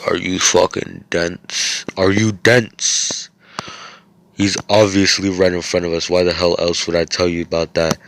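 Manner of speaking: loud, incredulous retold rant; exasperated scolding. Yuno berating Klaus for doubting him, loud and exasperated. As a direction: come down hard on each word, mock-exasperated.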